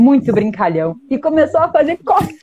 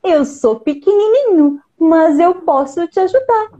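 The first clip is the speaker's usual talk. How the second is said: higher sweet little bird voice; cartoon bird voice; 6 semitones higher than usual. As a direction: speak like a brave tiny bird. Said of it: "bird speaking".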